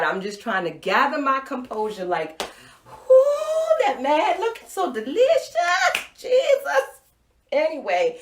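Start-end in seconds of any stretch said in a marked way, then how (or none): 2.9-6.9 much higher, loud breathless swoon; swooning whoop. Retold or imitated. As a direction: melt over a gorgeous man. Swooning over the teacher.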